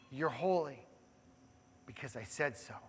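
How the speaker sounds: very soft, low declaration; almost whisper the promise; hushed voice of God. Acted. Pastor repeats God's claim on the church, hushed.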